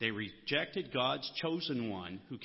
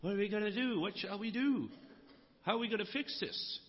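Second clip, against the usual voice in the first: higher, anxious imitated panic; pitched-up imitation of frightened listeners; fearful panic; 8 semitones higher than usual. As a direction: voice the crowd's mild worried panic. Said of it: "You can almost hear the fear in their voice".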